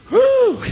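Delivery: very high, short "woo"; exultant whoop. Real, not acted. Whoop between quiet remarks.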